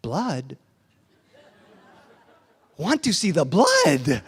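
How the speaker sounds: put-on slow, suddenly fascinated child; no longer crying. Son just told his chin bleeds, tears stopped, intrigued. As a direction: stop the tears and ask with slow wonder. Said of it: "He stopped crying and said".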